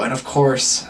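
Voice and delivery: ordinary talk, nothing marked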